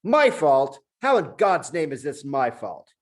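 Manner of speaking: high, loud outraged monkey voice; can't believe the accusation; righteous indignation. Acted. The indignant monkey shouts back at Julian. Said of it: "in righteous indignation".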